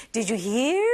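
"Did you hear?" with the tune climbing on "hear"; rising intonation. Shown as a demonstration. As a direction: let "hear" glide upward as a question. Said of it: "It should go up".

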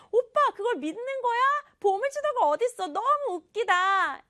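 high teasing ridicule; mocking teasing. Sister teasing her brother, high mocking voice. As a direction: tease big brother for believing it.

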